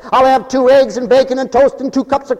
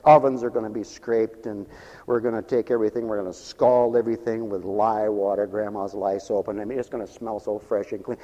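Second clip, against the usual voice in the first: lower, softer bakery boss pep talk; 8 semitones lower, 6 dB quieter than usual. Acted. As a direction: boss rallying the staff, low and soft. Voicing bakery boss to staff.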